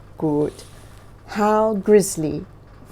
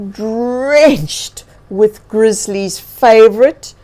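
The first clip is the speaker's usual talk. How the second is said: louder, slow, savoring reveal; excited reveal; 2 semitones higher, 8 dB louder, slower than usual. A narrator's reading. Storyteller revealing honey to children, louder and savoring. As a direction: build it up like a treasure.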